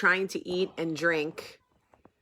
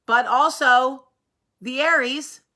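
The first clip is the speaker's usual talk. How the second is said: mock objector; 6 semitones higher, 9 dB louder, slower than usual. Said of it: "I don't need someone going".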